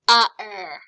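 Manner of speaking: "A-er" run open-mouthed, no consonants; vowels only. Shown as a demonstration. Teacher sounding the other word, vowels only. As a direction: loudly sound out "A-er" without closing the mouth. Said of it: "Just the vowel sounds without closing your mouth".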